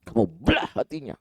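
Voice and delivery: high-pitched retold rebuke, not shouted; incredulous, mildly angry repeat; angry challenge. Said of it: "Nabi marah, Nabi bilang gini". (teguran marah bernada tinggi)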